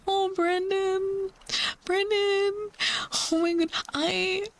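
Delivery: high, slow, moved cry of a name; touched. Real, not acted. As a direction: melt at the kind words. Touched by viewer's kind message.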